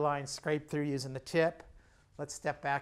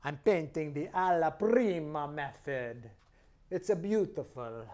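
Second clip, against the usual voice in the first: fake Italian accent. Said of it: "You can even do a little fake Italian accent".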